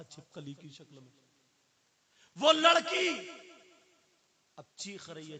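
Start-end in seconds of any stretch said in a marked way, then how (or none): 2.4-3.3 very high, very loud preacher's cry; a preacher crying out about a girl's punishment, very high and loud; dramatic cry. A narrator's reading. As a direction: cry it out to shake the listeners.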